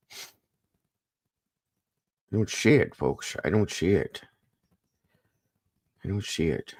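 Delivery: lightly imitated catchphrase with slushy "sh" for s; Chris McDonough impression. Streamer quoting Mister Chris to viewers, lightly imitated. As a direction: say "see" as "shee", trailing off softly. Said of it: "As Mister Chris McDonough would say".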